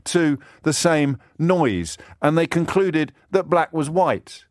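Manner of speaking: high-pitched, impassioned indignation at normal volume; indignant tirade. Real, not acted. Indignant tirade against Brexit cheerleaders.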